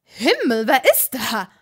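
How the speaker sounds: loud, startled witch calling out; jumpy shout at intruders; startled outburst at the door; startled cry. A narrator's reading. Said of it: "fragte eine Mitvierzigerin und fuchtelte".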